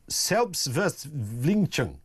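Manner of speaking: slow, laboured German pronunciation; struggling German accent. Shown as a demonstration. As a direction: wrestle with the German word, sound by sound. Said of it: "I'm gonna struggle with this one".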